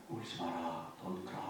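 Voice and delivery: very soft, low, gentle hush; soft tender comfort to a weeping woman. Acted. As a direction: soothe a weeping widow almost in a murmur. Jesus comforting the grieving widow.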